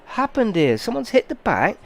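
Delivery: high-pitched mock outrage; retold angry shock. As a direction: replay the moment you saw the damage. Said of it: "I was going mental".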